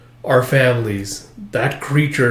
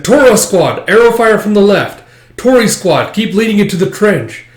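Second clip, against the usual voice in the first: higher, loud field commands; commander barking orders; 8 semitones higher, 6 dB louder than usual. Acted. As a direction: call orders across the battlefield. Commander giving battle orders.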